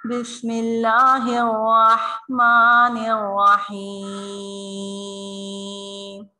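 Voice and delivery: slow melodic tajweed chant; teacher reciting Bismillah for students; Quran recitation. Shown as a demonstration. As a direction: recite it slowly, drawn out. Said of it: "आप लोग गौर से सुनिएगा".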